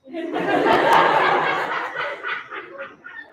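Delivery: airy giggling laugh. Acted. Laughing at own joke.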